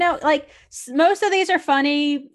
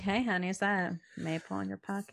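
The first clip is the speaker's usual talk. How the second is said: much softer, teasing come-on; soft flirty; 4 semitones lower, 11 dB quieter, faster than usual. Pretend. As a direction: murmur it to your fiance. Using line on fiance.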